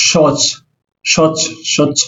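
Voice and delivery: "সচ" as sh and chh run together; "সচ" as one blended sh-chh sound; blended consonants. Shown as a demonstration. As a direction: say "সচ" with the two consonants joined. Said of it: "তালিবার স আর ছ এর সংযুক্ত মিলিত হয়ে উচ্চারণ".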